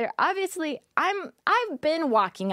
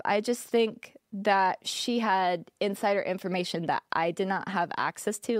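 ordinary talk, nothing marked

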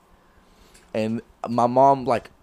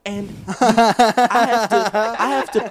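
exasperated laugh; 8 semitones higher, 6 dB louder, much slower than usual. Retold or imitated.

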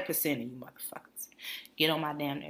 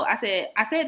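usual speaking voice

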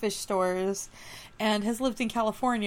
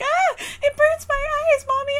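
much higher, louder mock child wail; whiny kid; 5 dB louder than usual. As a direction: whine like a kid in the water. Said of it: "the kid's gonna swim through it and been like".

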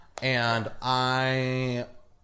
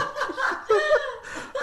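shrieking laugh; cracking up at the cohost; high laughing burst; 3 dB louder than usual. Real, not acted. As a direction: squeal of laughter.

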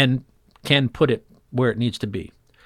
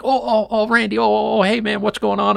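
high, eager hey man; excited greeting; 9 semitones higher than usual. Retold or imitated. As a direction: gush it out like an old pal. John greeting them on the water.

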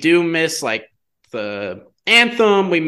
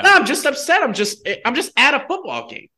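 defensive retort; 10 semitones higher than usual. Real, not acted. Sarcastic reply to "you're just upset.

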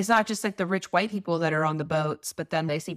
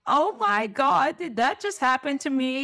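slow, higher, startled; stunned disbelief; 6 semitones higher than usual. Retold or imitated. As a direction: gasp it out in disbelief. Retold shock in the lake.

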